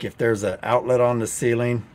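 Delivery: ordinary talk, nothing marked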